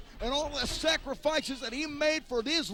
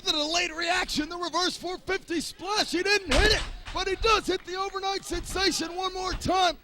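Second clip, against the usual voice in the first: high, loud excited call; 6 semitones higher, 4 dB louder than usual. Real, not acted. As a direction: sell the big moment.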